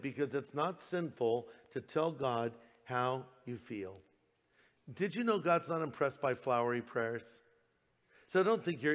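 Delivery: usual speaking voice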